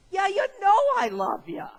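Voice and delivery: high, loud, fed-up answer; exasperated. Pretend. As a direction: blurt it out, sick of being asked. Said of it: "You'd be exasperated".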